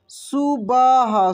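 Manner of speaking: slow teacher's demo of सुबह. Shown as a demonstration. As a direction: pull सुबह out slowly for the class.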